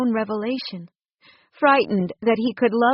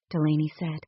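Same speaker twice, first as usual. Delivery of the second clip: plain reading voice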